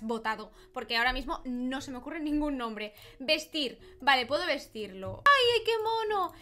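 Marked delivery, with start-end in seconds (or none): 5.2-6.3 high, loud cooing outburst; adoring squeal. Real, not acted. Player dresses the baby, squealing in adoration. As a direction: squeal at how cute it is.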